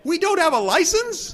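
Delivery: very high, loud disbelieving cry; shocked shout. Acted. Stunned realization.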